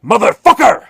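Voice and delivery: put-on an insulted old actor's screaming fit; raging tantrum. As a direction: explode in a foul-mouthed fit of rage. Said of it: "going off on this horrible tantrum".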